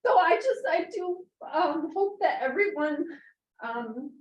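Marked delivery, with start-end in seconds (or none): 0.0-3.0 high, strained voice near tears; pleading while still crying; real tearful plea. Real, not acted. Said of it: "I'm very emotional".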